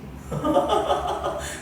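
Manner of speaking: a bright airy laugh; high breathless laugh. Real, not acted. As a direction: laugh at the answer. Laughing at a reply. (높고 숨섞인 웃음)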